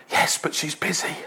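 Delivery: put-on small, breathy child whispering; child's whisper. Small boy whispers to salesman on phone. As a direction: whisper it like a scared little boy.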